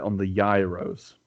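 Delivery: "gyros" said as "YAI-rows", drawn out; own pronunciation. Shown as a demonstration. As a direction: say "gyros" slowly with an "eye" vowel, like "YAI-rows". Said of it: "as I pronounce it".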